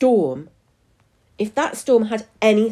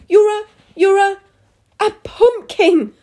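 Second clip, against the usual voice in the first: stammered, high, loud shriek; stammer, then scream the last word; horrified scream; 14 semitones higher, 9 dB louder than usual. A narrator's reading. Mum faces her orange daughter, screaming. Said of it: "her mum screamed".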